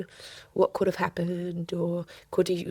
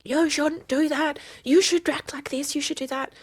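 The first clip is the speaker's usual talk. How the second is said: scolding parent; 7 semitones higher, 3 dB louder than usual. Retold or imitated. Hounding parents voiced.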